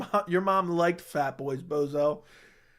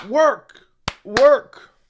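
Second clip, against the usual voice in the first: loud, high barked coaching yell; coach yelling work; 6 semitones higher, 11 dB louder than usual. Retold or imitated. Mark Smith cornering, urging him on with loud yells. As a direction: push the fighter on with a loud shout. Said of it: "Mark Smith was like".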